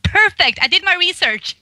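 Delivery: high, bright, triumphant exclamation; triumphant glee. Retold or imitated. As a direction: crow proudly about a job well done. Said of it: "It was like".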